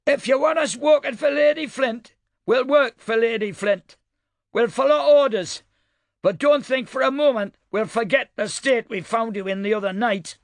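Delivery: put-on old woman's northern Fatrastan twang, pitched high; northern Fatrastan accent, high and weathered. A narrator's reading. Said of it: "her northern Fatrastan twang".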